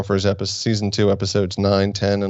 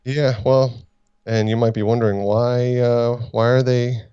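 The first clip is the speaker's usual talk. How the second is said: ordinary talk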